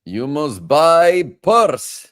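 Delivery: slow, loud, high mock Russian; Russian accent. Joking about shopping, hammed-up Russian accent. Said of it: "You get to be Russian today".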